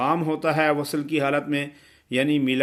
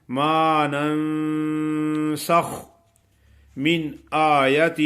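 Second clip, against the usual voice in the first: slow, drawn-out Quranic recitation; tajweed recitation; much slower than usual. Acted. Teacher reciting the verse for students, slow tajweed chant. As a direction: recite the verse slowly with careful nasalization.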